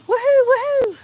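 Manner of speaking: very high, loud double whoop; celebratory cheer. Pretend. Streamer cheering Epic reaching level two, loud high whoops.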